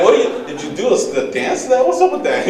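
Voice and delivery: put-on a kid asking mom why she's dancing, incredulous. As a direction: climb up in mock outrage. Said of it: "I'm like".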